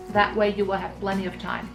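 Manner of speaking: normal talking voice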